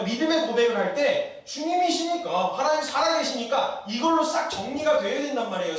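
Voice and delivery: high-pitched, insistent sermon delivery; impassioned preaching. Real, not acted. Preacher urging the congregation to simply trust God, impassioned. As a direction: drive the point home to the congregation.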